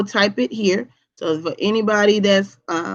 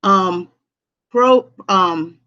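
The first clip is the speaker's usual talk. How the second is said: usual speaking voice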